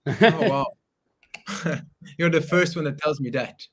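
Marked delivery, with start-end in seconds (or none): none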